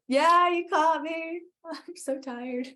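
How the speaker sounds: high, slow, laughing confession; laughing sheepishly. Retold or imitated. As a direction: laugh through the admission, drawn out. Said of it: "I was like".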